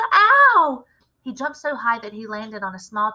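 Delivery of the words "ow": much higher, much louder yelp; yelling in pain. A narrator's reading. Bruno yells as if stuck with a pin. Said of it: "he yelled".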